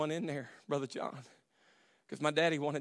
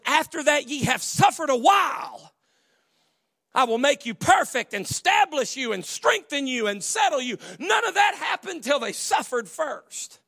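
shouted sermon line; 12 semitones higher, 8 dB louder than usual. Acted. Preaching at full force.

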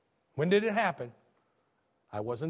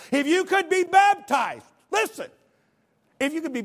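preaching at full force; 12 semitones higher, 8 dB louder than usual. Acted. Preacher grabs the congregation's attention, high and loud. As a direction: stop them cold.

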